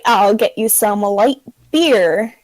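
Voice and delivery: ordinary delivery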